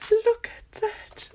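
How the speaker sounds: high, near-crying exclamation; almost in happy tears; tearful delight. Real, not acted. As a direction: overcome with joy. Overwhelmed by the gift.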